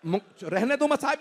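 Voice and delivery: ordinary delivery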